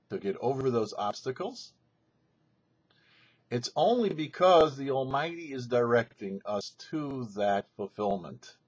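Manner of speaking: ordinary delivery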